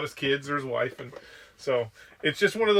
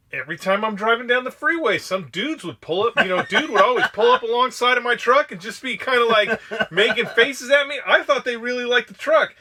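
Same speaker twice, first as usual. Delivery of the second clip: higher, louder, laughing through the retelling; laughing gunny story; 8 semitones higher, 6 dB louder than usual. Retold or imitated. Gunny explains the freeway drivers to the guys, laughing retelling. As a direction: tell it as if you can't believe it yourself. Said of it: "He's like".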